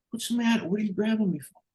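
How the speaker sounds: squeaky caught-out whine; high mock protest. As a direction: imitate the cornered guy, high and whiny. The ripper-off caught by arm.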